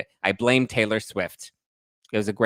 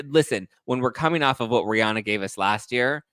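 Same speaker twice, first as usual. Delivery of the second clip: ordinary talk, nothing marked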